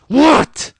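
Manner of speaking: very loud, high shout; furious demonstrated outburst; explosive snapped yell. Shown as a demonstration. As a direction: spit it out in a sudden rage. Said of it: "Like, it was that fucking".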